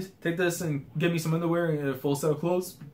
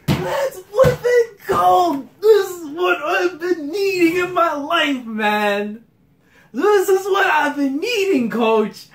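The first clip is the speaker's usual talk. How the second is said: very high, loud, stretched squeals of joy; slow, near-crying falsetto hype; ecstatic fan scream; 6 dB louder, much slower than usual. Real, not acted. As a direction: scream it like your favorite just came back. Fan screams over character's return.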